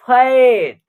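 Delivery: "फैट" with a long 'ae' vowel; teacher's drawn-out "फैट", A as 'ae'; open 'ai' sound. Shown as a demonstration. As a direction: stretch the 'ai' in "फैट" as a model. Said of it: "ए का उच्चारण ऐ के रूप में".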